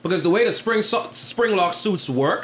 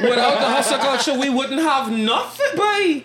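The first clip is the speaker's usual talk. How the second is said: laughing; 8 semitones higher than usual. Real, not acted. Laughing echo of hustle culture.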